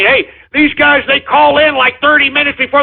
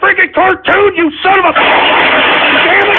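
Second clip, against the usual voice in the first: angry; 8 semitones higher than usual. Real, not acted. Host furious at the prank troll.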